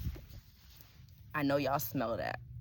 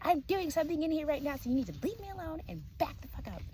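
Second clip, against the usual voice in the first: imitating her in a much higher voice, fast at the end; hammed-up bossy woman shooing him away; mock scolding woman; 12 semitones higher, faster than usual. Retold woman telling him off.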